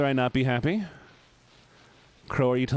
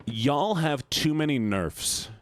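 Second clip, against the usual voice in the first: slow, drawn-out "Y'all", higher pitch; emphatic commiseration; 5 semitones higher, much slower than usual. Real, not acted. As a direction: stretch "Y'all have too many nerfs" for emphasis. Commiserating, first emphatic take.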